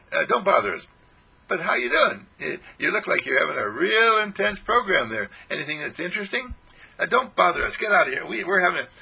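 playful grumpy get out; annoyed brush-off. Pretend. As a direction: shoo them away, hammed-up. Acted annoyed group reply.

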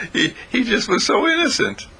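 squeaking upward with amusement; laughing. Real, not acted. Teacher telling how innocent the Buddha was, laughing. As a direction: talk through real laughter, pitch high. Breaks into laughter mid-explanation.

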